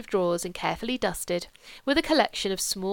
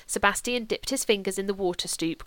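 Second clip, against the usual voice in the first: usual speaking voice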